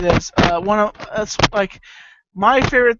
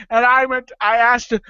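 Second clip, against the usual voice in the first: normal talking voice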